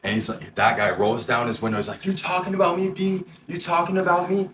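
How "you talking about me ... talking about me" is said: high-pitched, indignant demand; confrontational challenge. Retold or imitated. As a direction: imitate a man looking for a fight. Acted challenge from the angry man.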